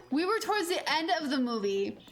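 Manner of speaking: usual speaking voice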